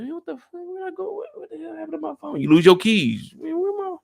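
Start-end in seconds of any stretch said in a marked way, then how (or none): 0.0-2.4 much higher, soft, fast panicky mutter; frantic mock search. Imitating a man hunting for his phone, high panicky mutter. 3.4-4.0 much higher, soft, fast panicky mutter; frantic mock search. Imitating a man hunting for his phone, high panicky mutter.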